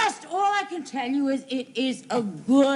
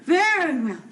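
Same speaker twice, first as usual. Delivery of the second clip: giggling through the words; can't stop laughing. Acted. Mary laughing at Merle stumbling, giggling through it.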